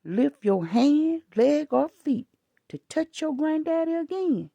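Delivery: retold furious warning to a child in Walmart; angry scolding. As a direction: lay down the law to a misbehaving kid. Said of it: "I said".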